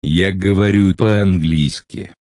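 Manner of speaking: normal talking voice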